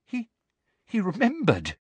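high, halting frightened voice; faint with fright. A narrator's reading. Girl recognised by a giant, faint halting fear. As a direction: stammer it as if about to faint. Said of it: "looking as though she was about to faint".

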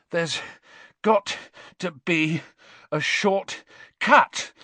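breathless, slow words between gasps; out of breath from the stairs; panting. A narrator's reading. Ron climbing the seventh staircase, panting. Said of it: "Ron panted".

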